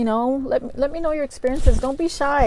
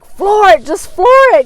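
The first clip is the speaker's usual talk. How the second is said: higher and louder frantic command; urgent yelling; 9 semitones higher, 8 dB louder than usual. Retold or imitated. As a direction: yell at the driver to speed off. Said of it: "he just starts, like".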